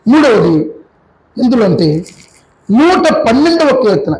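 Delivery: usual speaking voice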